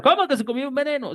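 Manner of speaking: acted shocked question, jumping up in pitch; alarmed. Startled listener questioning the gringo, mock alarmed. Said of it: "usted se va a asustar".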